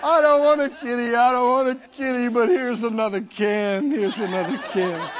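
high, loud, laughing mock protest; hammed-up pleading with the kitty, laughing. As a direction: protest while giving in, laughing. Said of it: "You're going".

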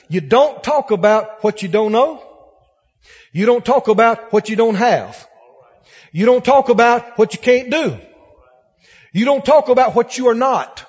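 loud, hammering repetition on each line; forceful preaching. Real, not acted. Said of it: "listen to me now".

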